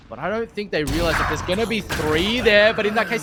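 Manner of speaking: lively play-by-play call; esports caster. Acted. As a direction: push the energy up. Live play-by-play.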